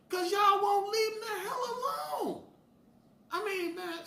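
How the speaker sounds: high, loud, slow shout. Real, not acted. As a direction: yell it out in outrage. Ranting shout about women.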